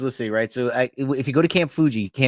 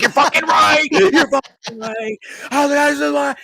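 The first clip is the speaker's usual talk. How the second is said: screaming high and loud, losing it; furious yell; 5 dB louder than usual. Retold or imitated. Yelling at the Marines who mislabeled the cans, full fury. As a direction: yell it like you just kicked the wall over. Retold blowup at the wall.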